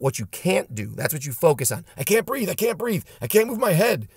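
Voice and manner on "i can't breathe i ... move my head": high-pitched choke-victim panic; mock panic.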